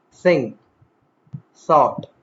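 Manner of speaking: "think" and "thought" each begun with a voiceless TH; unvoiced TH. Shown as a demonstration. Teacher showing the unvoiced sound, carefully articulated. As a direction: start "think", "thought" on air, isolated words.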